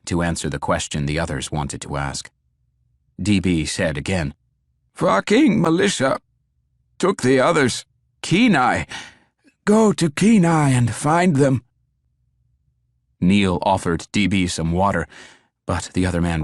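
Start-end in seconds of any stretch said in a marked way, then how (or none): 4.9-11.6 high, louder, desperate dying plea; dying man voice. A narrator's reading. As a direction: gasp it out with urgency. Dying man repeats urgently.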